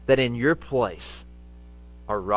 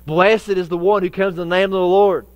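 raised, higher crowd cry of praise; 7 semitones higher, 7 dB louder than usual. Acted. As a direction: cry it out loudly like a welcoming crowd. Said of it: "Would you cried out".

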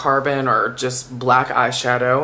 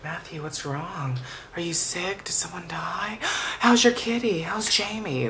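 mock hushed, concerned friend asking questions; worried friends; 5 semitones higher, 4 dB quieter than usual. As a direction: imitate friends fretting over him, voice dropping to a hush then a gasp. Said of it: "people would be like".

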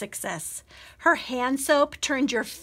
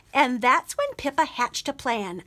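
ordinary delivery

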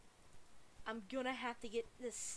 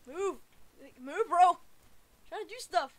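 high, loud impatient calls; irritated yell; 6 semitones higher, 14 dB louder than usual. Real, not acted. Gamer telling bro to move, irritated yell. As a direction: shout at someone blocking you. Yelling at player blocking him.

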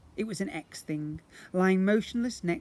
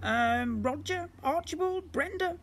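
high creature voice; 13 semitones higher than usual. Acted. Slime suggests names.

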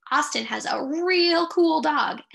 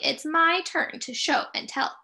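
ordinary delivery

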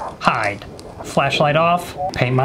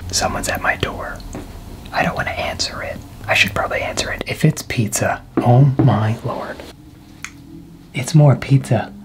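hushed unvoiced whisper. Real, not acted. Pizza guy at the door, whispering to the viewers. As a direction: whisper so the delivery guy can't hear. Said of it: "I can't be too loud. The pizza guy will hear me".

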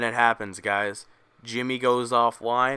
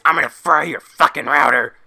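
yelled threat, much louder, voice pushed out of its usual shape; angry nerd shout; 3 semitones higher, 9 dB louder than usual. Acted. Bullied hacker screaming back at the bully, furious shout. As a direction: scream it like a furious kid about to snap. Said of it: "all he can say is".

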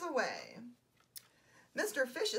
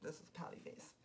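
breathy whispered aside; whispered demo; 7 semitones lower, 13 dB quieter, faster than usual. Shown as a demonstration. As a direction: whisper it while pulling a pout. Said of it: "a pout is like".

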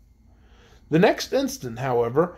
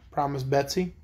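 usual speaking voice

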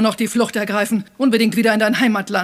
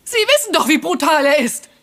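high, urgent protest; desperate pleading; 7 semitones higher than usual. Acted. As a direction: beg them to believe you. Accused wife pleading about husband.

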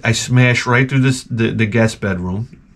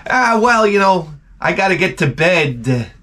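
mock excuse; 6 semitones higher than usual. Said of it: "they were like".

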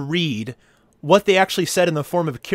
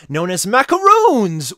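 "macaroons" pitched up and louder, last syllable stretched; emphasis on macaroons; 4 semitones higher, 6 dB louder, slower than usual. Shown as a demonstration. Host rereading the recipe history, hammed-up stress on "macaroons". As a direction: punch "macaroons" grandly as you read. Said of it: "put the emphasis on macaroons".

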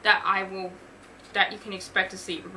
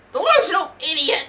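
loud, high shouted insult; 7 semitones higher, 12 dB louder than usual. A narrator's reading. Gym employee yelling after Thor, insulting shout. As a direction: shout it after someone leaving. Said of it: "the employee shouted".

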